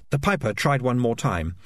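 usual speaking voice

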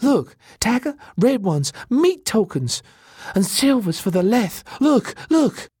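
very high, eager character voice; excited. A narrator's reading. Elena shows Taka the tokens, high and thrilled. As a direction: exclaim over each find. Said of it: "she said excitedly".

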